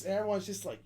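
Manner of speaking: normal talking voice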